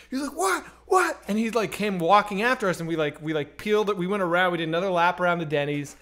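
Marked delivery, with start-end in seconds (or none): 0.4-1.2 high, quick repeated challenge; indignant reaction. Retold or imitated. Insulted gangster walking after the car, indignant. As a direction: imitate an affronted man calling after someone.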